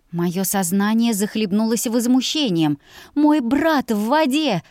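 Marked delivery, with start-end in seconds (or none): 3.1-4.6 high, sharp snap; barking. A narrator's reading. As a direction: snap at him in alarm. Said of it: "рявкнула я".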